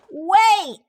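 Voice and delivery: high call after someone. Acted. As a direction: call out to stop him. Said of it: "calls Pete".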